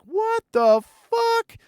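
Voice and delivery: very high, slow, stretched-out disbelief; shocked reaction. Retold or imitated. Seeing the old school friend again, stretched high shocked squeak. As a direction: draw out a squeaky, stunned what-the. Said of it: "and I'm like".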